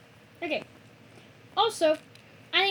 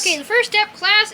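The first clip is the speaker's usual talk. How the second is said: louder, announcing to the class; loud teacher; 3 semitones higher, 10 dB louder than usual. Pretend. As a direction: call the class to order. Teacher addressing class loudly.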